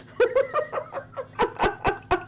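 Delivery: high squealing laughter; high giggle fit. Real, not acted. Streamer laughing as Nala beats her score, high giggle fit. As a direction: giggle helplessly.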